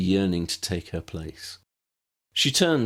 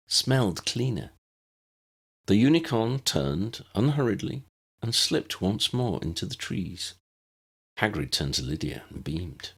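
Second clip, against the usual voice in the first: ordinary delivery, nothing marked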